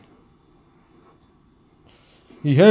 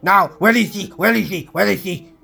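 higher, fast, agitated retold demand; 6 semitones higher than usual. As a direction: bark the questions, hunting for her. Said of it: "he demanded".

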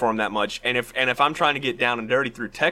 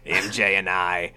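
Green Goblin line; 4 semitones lower than usual. Retold or imitated. Reading the Goblin's threat to friends, low and slow. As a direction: gloat low then burst out. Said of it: "read you this line from the Green Goblin".

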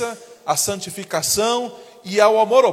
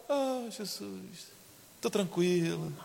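slow, very soft, dreamy calm; much softer mock serene sigh; 4 semitones lower, 15 dB quieter, slower than usual. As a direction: play the sedated convert, drowsy and slow. Mocking overly calm new convert.

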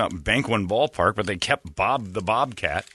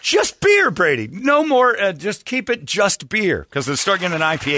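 excited; 11 semitones higher, 5 dB louder, slower than usual. Real, not acted. Excited insisting.

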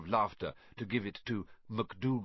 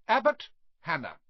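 calling out a name; 7 semitones higher, 6 dB louder than usual. Acted. Teacher calls out a name.

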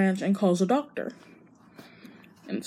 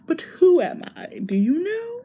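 higher, teary plea; distressed plea; 9 semitones higher than usual. Retold or imitated. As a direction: ask it close to tears. Amnesiac asks who she is.

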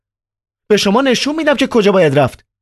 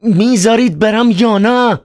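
terrible shout. Acted. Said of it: "با صدای وحشتناکی فریاد زد".

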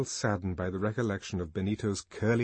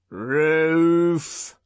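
long, high, loud creak; high creaking liftman's cry; creaking call; 7 semitones higher, 8 dB louder than usual. A narrator's reading. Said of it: "called a creaking voice".

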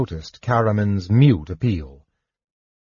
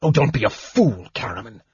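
exasperated snap. A narrator's reading. Mage scolding his brother, a mildly exasperated snap. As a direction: snap at him, fed up. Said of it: "Raistlin snapped in exasperation".

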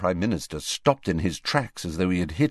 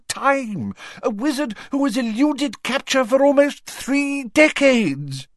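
very high, indignant tirade; outraged protest, rising to loud stressed peaks; heated; 4 dB louder than usual. A narrator's reading. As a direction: defend yourself hotly, hammering key words. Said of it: "demanded Fudge heatedly".

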